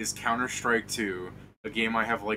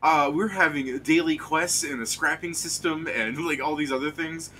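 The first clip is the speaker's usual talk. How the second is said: higher-pitched, mildly mocking salesman; imitated scammer pitch; 6 semitones higher, 4 dB louder than usual. Retelling how the guy defended his banana game, lightly mimicked pitch. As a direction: lightly mimic someone defending their project. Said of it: "He goes".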